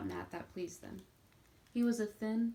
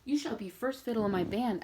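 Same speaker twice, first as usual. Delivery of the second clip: eager exclamation; 3 semitones higher, 8 dB louder than usual. A narrator's reading. Franz promising Nat a place in his band, loud and proud. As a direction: call it out proudly across the room. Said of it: "added Franz".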